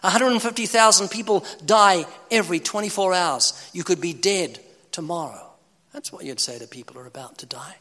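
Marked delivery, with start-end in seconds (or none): 5.9-7.8 hushed whisper of the same line; whispered aside. Shown as a demonstration. Preacher repeats his point to the congregation in a whisper. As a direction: breathe it as a private aside.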